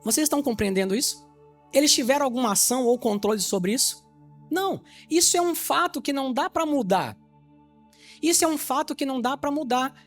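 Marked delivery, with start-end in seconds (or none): none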